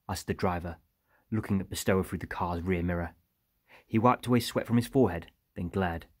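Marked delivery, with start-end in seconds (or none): none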